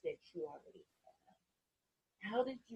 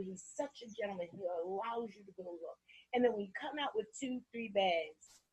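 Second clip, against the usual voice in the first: normal talking voice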